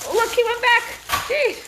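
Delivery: high, loud surprised exclamation; surprised squeal. Real, not acted. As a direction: squeal in amused disbelief. Surprised at puppy.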